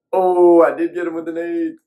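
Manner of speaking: high, loud burst of triumph; excited shout. Real, not acted. Gamer cheering his grenade kill to himself, excited shout. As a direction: yelp it out, thrilled he got him.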